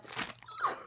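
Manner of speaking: high airy giggle; a delighted breathless laugh; breathy squeal laugh. Real, not acted. Squishing the toy.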